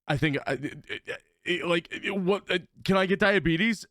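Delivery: stammering, imitated worry; mock-panicked false starts, rising to the question; retold panic. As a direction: act out a nervous friend stumbling into his question. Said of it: "Why do you sound so panicked".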